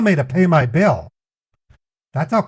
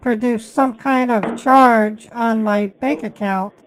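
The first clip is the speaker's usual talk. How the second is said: higher pretend caller voice, slightly slower; put-on caller voice; 6 semitones higher, slower than usual. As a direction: play an innocent caller, pitched up. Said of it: "I have to literally pretend".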